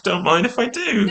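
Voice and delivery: high, laughing mock eagerness; playful. Actor gleefully accepting an extra take, high and laughing. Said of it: "an extra take".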